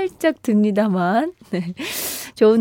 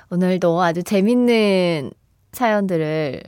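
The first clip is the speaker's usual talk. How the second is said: ordinary delivery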